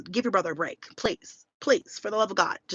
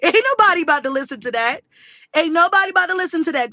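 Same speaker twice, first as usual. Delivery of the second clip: loud, fast, higher-pitched brush-off; scoffing dismissal; 8 semitones higher, 6 dB louder, faster than usual. Retold or imitated. Daughter brushes off grandma's plea, loud fast scoffing. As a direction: shut it down with hammed-up scorn.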